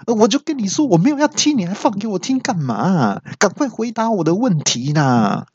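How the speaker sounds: high-pitched, rushed rant at the start; angry outcry. Acted. User demanding an answer from the voice assistant, angry high outcry. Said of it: "我就气到大叫说".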